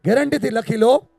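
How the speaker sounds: high, very loud, emphatic declaration; forceful preaching. Real, not acted. Speaker challenges parents over unspoken love, forceful preaching. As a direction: hammer it home at full volume.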